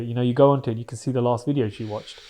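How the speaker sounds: normal talking voice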